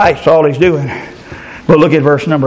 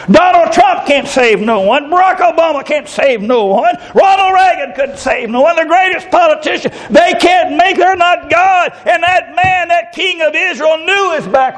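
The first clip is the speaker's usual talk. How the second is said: raised, fervent preacher's voice, slowing on key words; preaching at full force; 11 semitones higher than usual. Acted. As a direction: preach it out to the congregation. Preacher listing, rising.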